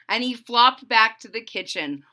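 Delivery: usual speaking voice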